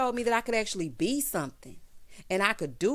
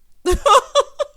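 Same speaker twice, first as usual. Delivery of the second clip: burst of laughter; 14 dB louder than usual. Real, not acted. Laughing at his reply.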